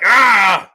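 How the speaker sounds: loud, high "Ah!" of frustration; short angry shout; frustrated yell. Retold or imitated. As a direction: bark it out like a fighter who knows he lost. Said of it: "he gets up and he's like".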